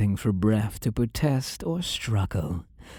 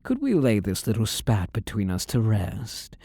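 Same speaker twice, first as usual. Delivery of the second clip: ordinary delivery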